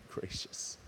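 breathless hushed whisper. Real, not acted. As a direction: barely breathe the word. Overcome while preaching.

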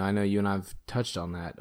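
ordinary delivery

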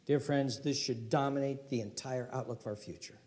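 usual speaking voice

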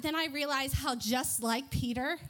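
ordinary talk, nothing marked

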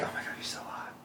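hushed, breath-only swoon; swooning whisper. Retold or imitated. Remembering the attractive ex she dated, a swooning whisper. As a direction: whisper it as a private thought.

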